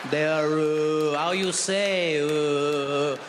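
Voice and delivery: slow, low stall on long fillers; hesitant French. Acted. Soldier failing to report Germans, hesitant drawl. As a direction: grope endlessly for the word in mock French. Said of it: "French is too hesitant".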